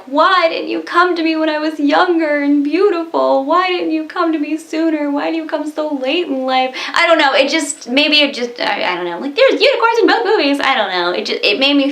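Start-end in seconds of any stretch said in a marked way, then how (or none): 0.0-6.7 raised-pitch wistful plea, lightly acted; a touch of a sorrowful plea in a higher voice; lightly voiced lament from the old film; quoting the girl. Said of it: "the girl is like".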